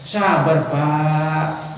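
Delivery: low, gentle calming; soothing doctor. Acted. Doctor asking the patient to be patient, soothing. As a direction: settle him down in a low voice.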